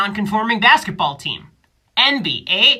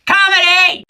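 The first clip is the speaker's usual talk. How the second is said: triumphant shout; 10 semitones higher, 8 dB louder than usual. Acted. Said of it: "And then it's".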